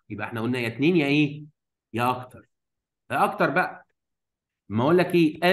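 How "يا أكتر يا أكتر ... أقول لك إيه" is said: normal talking voice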